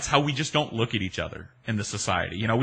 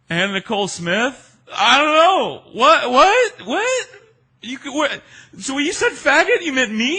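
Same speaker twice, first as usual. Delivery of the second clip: much higher, loud squeaky confusion; bewildered disbelief; 9 dB louder than usual. Retold or imitated. His stunned high-pitched reply.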